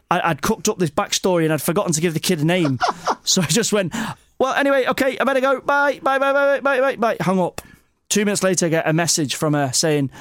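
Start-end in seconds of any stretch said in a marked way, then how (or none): 4.4-7.2 high, rushed stream of byes to escape the call; hurried flustered goodbye. Retold or imitated. Caught out on the phone, he babbles goodbye. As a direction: gabble goodbyes to get off the phone fast.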